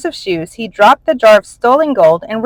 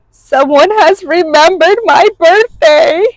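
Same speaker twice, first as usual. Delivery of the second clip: high, louder cry of delighted surprise; joyful cry; 9 semitones higher, 5 dB louder than usual. A narrator's reading. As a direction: burst out overjoyed. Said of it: "he cried".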